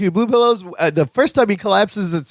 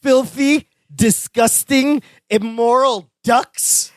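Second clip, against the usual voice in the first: higher and very slow, a mock outraged rant; outraged Balky; 6 semitones higher, much slower than usual. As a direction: draw out each word in disgust, imitating Balky.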